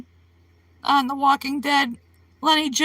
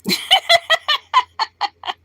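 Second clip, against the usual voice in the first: high laugh; 11 semitones higher than usual. Real, not acted. Laughing at own joke.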